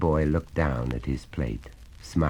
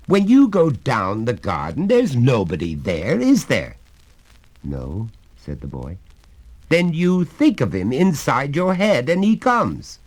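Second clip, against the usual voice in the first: higher, louder jovial father voice; a hearty father coaxing his son; genial father; 11 semitones higher, 10 dB louder than usual. Acted. Father reasons.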